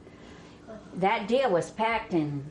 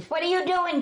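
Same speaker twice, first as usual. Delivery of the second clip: high voiced hired man; 11 semitones higher than usual. Hired man voiced in retold story.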